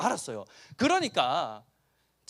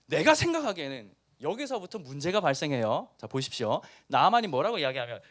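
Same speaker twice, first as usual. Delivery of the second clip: usual speaking voice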